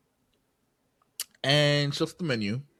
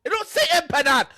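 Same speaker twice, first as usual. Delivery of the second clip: high, loud, worked-up rant; heated high yell about the menu; real yelling; 12 semitones higher, 3 dB louder than usual. Real, not acted. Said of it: "I'm, I'm yelling".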